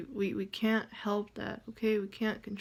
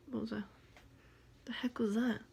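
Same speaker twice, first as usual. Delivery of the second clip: startled whisper; 2 semitones higher, 4 dB quieter, faster than usual. Real, not acted. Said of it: "there was some flying in my face".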